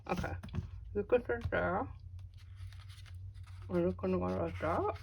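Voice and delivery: talking around a paintbrush held in teeth; paintbrush in mouth. Real, not acted. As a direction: speak with a brush clamped in mouth. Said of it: "talking with a paintbrush in my mouth".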